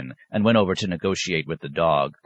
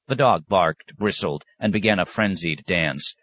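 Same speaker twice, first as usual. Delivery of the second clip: plain reading voice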